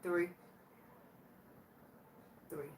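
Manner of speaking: ordinary talk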